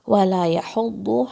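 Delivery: وَلَا with a round واو, not a v sound; slow recitation. Shown as a demonstration. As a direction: recite وَلَا يَحُضُّ carefully. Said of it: "واو کو آپ نے وی کی طرح نہیں پڑھنا ہے".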